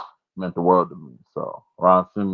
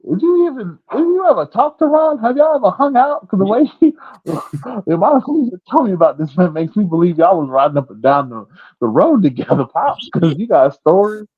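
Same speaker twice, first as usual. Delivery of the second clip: high, loud, incredulous questioning; mock disbelief; 12 semitones higher, 5 dB louder than usual. Son grilling his dad about Ron stories, incredulous. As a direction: press for answers in a raised, amazed voice.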